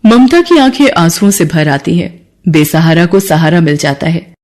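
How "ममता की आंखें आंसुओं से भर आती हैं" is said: ordinary delivery, nothing marked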